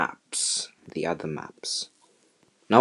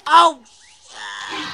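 shocked shout; 11 semitones higher, 4 dB louder than usual. Real, not acted. Sudden attack in game.

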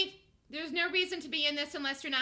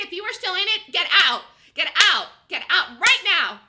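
loud, commanding yell; urgent shout; 4 semitones higher, 12 dB louder than usual. Real, not acted. Trader shouting at viewers to exit the trade. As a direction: bark the order to get out.

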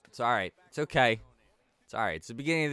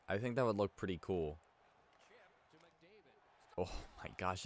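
usual speaking voice

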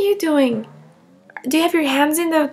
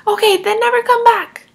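louder, higher snap; angry retort; 6 semitones higher, 5 dB louder than usual. Acted. Mother snapping at Claudia as she leaves. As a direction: fire it back in anger.

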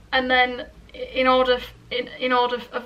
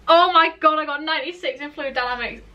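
loud, higher burst of joy; ecstatic disbelief; 4 semitones higher, 8 dB louder than usual. Real, not acted. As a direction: exclaim it, thrilled and amazed. Celebrates her exam mark to friend.

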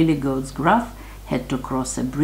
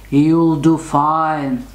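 slow, drawn-out storybook troll; 4 dB louder, much slower than usual. A narrator's reading. Troll turns down the goat's plea, slow troll voice. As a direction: dismiss it slowly as the big troll. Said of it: "the troll said".

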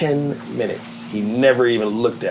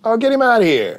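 imitating Martin; 11 semitones higher than usual. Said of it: "He'd be like this".

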